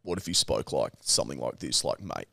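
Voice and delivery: very low, soft put-on voice; worst voice demo. Shown as a demonstration. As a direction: drop far down into an awful droning voice. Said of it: "one of the worst voices in the world".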